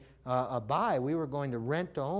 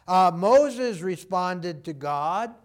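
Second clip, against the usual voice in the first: loud and slow, leaning on every word; preaching loud; 2 semitones higher, 10 dB louder, much slower than usual. Real, not acted. Preacher rousing the congregation with examples, loud and slow. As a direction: proclaim it to the back row.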